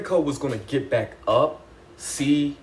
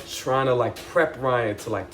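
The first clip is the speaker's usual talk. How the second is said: ordinary talk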